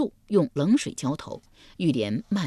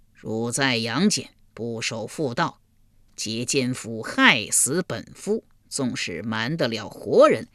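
stern King Yama; 3 semitones lower than usual. Acted. King Yama judges Yulian.

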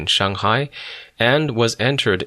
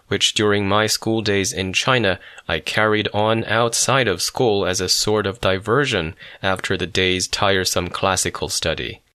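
ordinary delivery

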